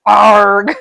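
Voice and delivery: loud mock pirate "Arg"; pirate growl. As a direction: bark it like a hammy pirate. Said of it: "just goes".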